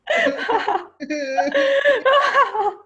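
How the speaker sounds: high, wild villain laugh; manic laugh. Acted. Teacher laughs at the class, high manic cackle. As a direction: cackle like a madman. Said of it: "Mister John laughed with so many emotions".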